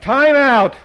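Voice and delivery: shout. A narrator's reading. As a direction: shout over the uproar. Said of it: "Art Palmer shouted".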